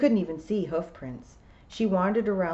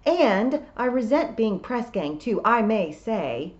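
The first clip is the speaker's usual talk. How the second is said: resentful; 5 semitones higher, 6 dB louder than usual. A narrator's reading. Toad complains of being press-ganged.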